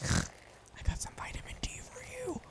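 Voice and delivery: slow, giggly whispered joke; cheeky slow whisper; slow ASMR whisper. Acted. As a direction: whisper the punchline slowly.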